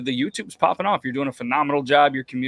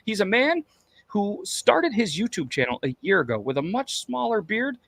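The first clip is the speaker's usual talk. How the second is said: announcer intro; 5 semitones higher than usual. Pretend. Host hyping tonight's guest to the audience, announcer intro. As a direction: build up the guest like a ring announcer.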